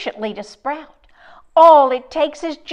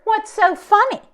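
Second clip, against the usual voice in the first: usual speaking voice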